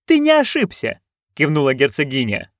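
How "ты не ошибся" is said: very high-pitched, haughty retort; imperious duchess. Acted. Duchess retorts to timid guard. (надменная герцогиня)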